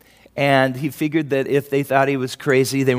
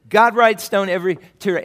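ordinary delivery

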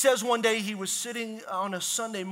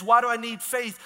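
normal talking voice

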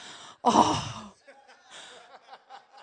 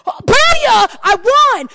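higher, louder victory whoop; gloating kid; 6 semitones higher, 4 dB louder, much slower than usual. Retold or imitated. Boy crowing at his parents after winning. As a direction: crow like a kid who just won. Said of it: "He was like".

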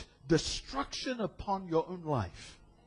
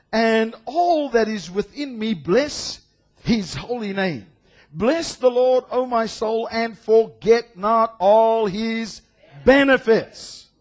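slow, louder proclaiming of the psalm; preached scripture; 3 semitones higher, 5 dB louder, slower than usual. Acted. Preacher to congregation, slow ringing proclamation. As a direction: stretch each word out like a proclamation.

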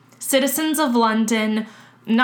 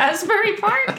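high, laughing disbelief; can't believe that answer, laughing; Asbury Park said high with a laugh; incredulous laughing echo; 11 semitones higher than usual. Real, not acted.

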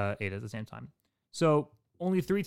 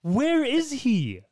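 high, slow laughing question; laughing disbelief; 12 semitones higher, 6 dB louder, much slower than usual. Real, not acted. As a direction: ask it through laughter.

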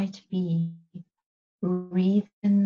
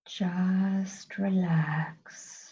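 very slow, soothing whisper; 5 dB quieter, much slower than usual. Acted. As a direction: draw it out in a hush. Whispered relaxation cue.

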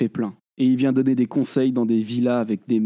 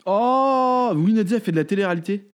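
vraie surprise; 7 semitones higher than usual. Real, not acted. Apprend que [name] était là.

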